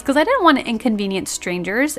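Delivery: ordinary talk, nothing marked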